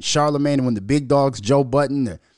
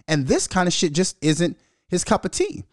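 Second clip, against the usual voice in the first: ordinary talk, nothing marked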